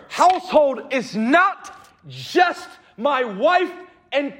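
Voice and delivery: loud, high, driving preacher voice; rising sermon fervour; full-force preaching. Acted. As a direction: preach it hard to the congregation.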